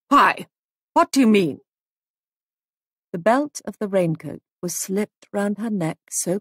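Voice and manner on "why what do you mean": louder, sudden startled questions; startled questioning. A narrator's reading. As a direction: demand an answer, taken aback. Woman alarmed at a threat.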